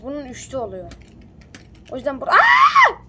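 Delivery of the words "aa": loud, very high startled yell; sudden shout. Real, not acted. Startled while explaining the pier, loud high yell. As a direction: yelp in sudden fright. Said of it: "Gereksiz yere bağırdım".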